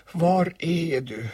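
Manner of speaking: high, slow searching call. Acted. Boy searches for his bear, calling out. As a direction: draw it out as if calling someone out of sight. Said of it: "ropade Kristoffer Robin".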